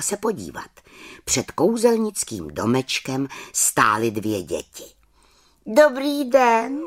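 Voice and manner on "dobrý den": high, slow little witch voice; little witch calling out. Acted. Little witch greets the children at her door. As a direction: call out a bright high greeting. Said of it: "zvolala malá čarodějnice".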